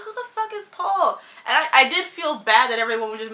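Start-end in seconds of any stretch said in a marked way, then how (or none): 0.0-1.2 higher, mock-baffled outburst; retold disbelief, pitched up; incredulous. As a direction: act floored by who this guy is. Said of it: "I was like".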